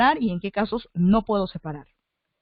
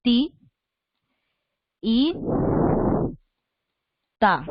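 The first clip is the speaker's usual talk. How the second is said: "Ti, i, ta" broken into separate syllables with long pauses; slower than usual. Shown as a demonstration. Teacher showing how to separate syllables, syllable by syllable. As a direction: say "Ti", "i", "ta" one at a time, pausing after each. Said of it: "la separo así".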